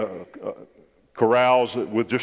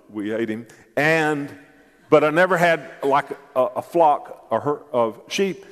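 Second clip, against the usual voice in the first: ordinary talk, nothing marked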